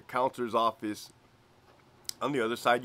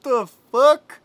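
much higher, much louder shocked yelp; officer stunned by his partner's talk, high yelp; 13 semitones higher, 13 dB louder than usual. Retold or imitated. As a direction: blurt out stunned disbelief. Narrator's shocked reaction.

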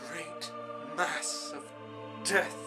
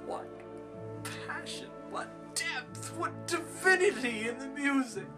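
high, swooning exclamation; rapturous praise; 13 semitones higher than usual. Acted. Imagined listeners praising the requiem, high and rapturous. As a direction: gush in awe at the music.

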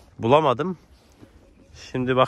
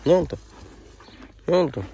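normal talking voice

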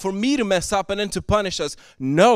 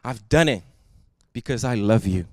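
tender; 8 semitones lower than usual. Pretend. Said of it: "He is saying".

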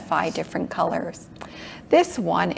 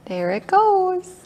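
excited; 11 semitones higher than usual. Acted. Fish swims away for kids.

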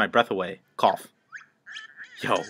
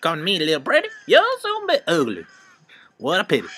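witch voice; 8 semitones higher, 3 dB louder, faster than usual. Acted. Witch taunting.

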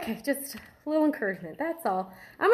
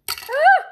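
startled yelp; 10 dB louder than usual. Real, not acted. Startled by a scorpion piece.

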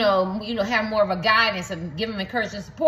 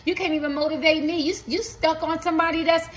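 higher, scolding a useless coach; mock the coach who can't motivate you, pitched up; fed-up client; 6 semitones higher than usual. Acting a fed-up paying client.

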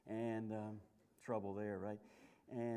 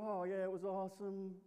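fake agreement; 6 semitones higher than usual. Husband fakes agreement.

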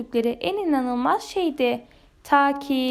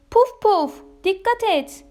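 high, urgent shout, loudest at the start; shouted warning; 5 semitones higher, 4 dB louder than usual. A narrator's reading. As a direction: warn a friend of danger. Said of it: "diye bağırdı". (bağırarak uyarı)

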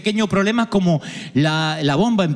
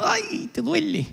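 mock pain; 8 semitones higher, 6 dB quieter, slower than usual. Dolor de arena en zapato.

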